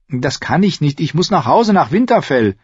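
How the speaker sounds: put-on high girl's voice with swooping pitch; protesting young girl. A narrator's reading. Girl insisting she must go home, high and urgent. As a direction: refuse urgently, voice lifted high. Girl Arya refuses to follow.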